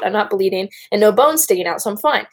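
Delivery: ordinary delivery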